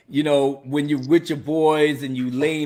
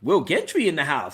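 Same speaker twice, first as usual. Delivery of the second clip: ordinary talk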